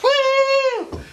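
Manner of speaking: excited whoop. Pretend. Figurine flung round the Earth.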